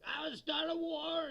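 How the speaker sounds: hammed-up wannabe warrior; mock McVeigh. Imitating McVeigh.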